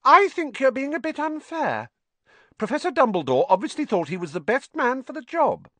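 put-on high, prim girl's protest. A narrator's reading. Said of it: "in a voice somewhat higher than usual".

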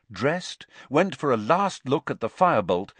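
ordinary delivery, nothing marked

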